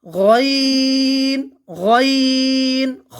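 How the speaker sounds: গইন and খ from the throat, drawn out; slow letter drill, stretching গইন and খ; articulation demonstration. Shown as a demonstration. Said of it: "উচ্চারণ করে দেখি আমরা".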